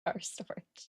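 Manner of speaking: "Our storage" breathed in a soft unvoiced whisper; posh whisper. Savoring a viewer's classy-sounding word in a whisper. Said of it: "sounds very classy".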